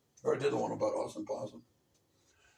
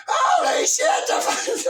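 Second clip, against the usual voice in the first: very high, slow drawn-out yell; hammed-up shock; shocked shout; 11 dB louder, slower than usual. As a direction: scream it in disbelief. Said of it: "I was like".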